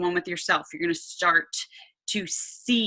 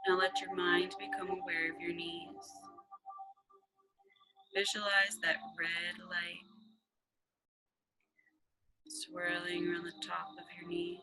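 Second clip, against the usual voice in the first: hushed, calm, half-whispered guidance; guided meditation; 9 dB quieter than usual. Acted. Guided meditation over music.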